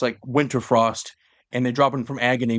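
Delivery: ordinary talk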